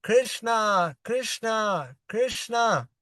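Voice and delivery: high, drawn-out calls of his name, each falling; plaintive calling. Retold or imitated. Friend calling fainted Krishna to wake, plaintive calling. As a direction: call him back with longing, not full volume. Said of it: "loudly call out to him with many plaintive cries".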